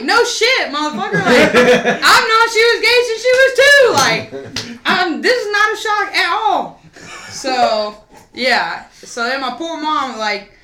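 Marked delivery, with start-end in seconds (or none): 0.0-6.8 high loud laughing scoff; laughing mom. Retold or imitated. Mom hearing the news, high laughing scoff. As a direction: laugh it off, much higher. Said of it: "And she was like".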